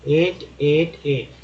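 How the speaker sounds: एट repeated three times, a crisp "et" with a clear final t; pronunciation drill. Shown as a demonstration. Teacher drilling students on the ET sound, carefully pronounced. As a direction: say एट as a clean model for the class. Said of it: "एटी को एट बोलते हैं".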